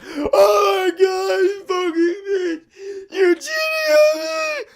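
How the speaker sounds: very high, slow, strained mock scream at held-back volume; imitated tearful, high-pitched screaming; crying scream. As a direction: wail it like a betrayed boyfriend, without full volume. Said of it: "crying, like, screaming".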